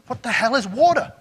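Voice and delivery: high, loud baffled outburst; a young fish truly bewildered. Retold or imitated. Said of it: "turn to each other and go".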